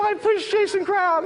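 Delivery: high, loud, laughing holler; hammed-up squealing announcement; mock confession shout. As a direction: call it out slowly through laughter.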